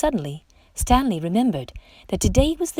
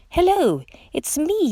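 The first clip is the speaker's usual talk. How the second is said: high, louder eager call; 10 semitones higher, 3 dB louder than usual. A narrator's reading. Stanley calling to a shimmer he thinks is a friend, high and eager. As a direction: call out brightly to a far friend. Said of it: "he called".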